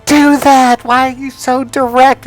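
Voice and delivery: high, loud mock wife scolding; hammed-up high wife, exasperated. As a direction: shriek at him like an appalled wife. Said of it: "His wife goes".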